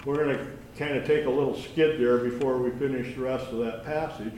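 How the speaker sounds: ordinary talk, nothing marked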